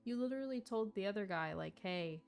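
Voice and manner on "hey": ordinary talk, nothing marked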